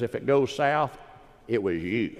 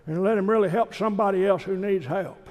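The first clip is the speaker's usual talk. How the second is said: higher, lightly voiced old father; dad's voice; 8 semitones higher than usual. Preacher voicing his dad on the phone, higher pitched. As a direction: play the father, brisk and amused. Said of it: "I called my dad back and said".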